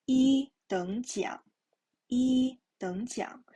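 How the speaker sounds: "一等奖" with "一" high and level; first-tone model. Shown as a demonstration. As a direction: hold "一" steady, then dip for "等奖". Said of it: "the tone is the first tone".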